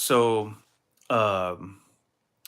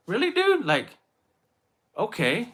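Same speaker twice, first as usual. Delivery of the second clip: much higher, stunned and sarcastic; incredulous; 10 semitones higher than usual. Retold or imitated. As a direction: act amazed nobody said thanks. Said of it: "I'm like".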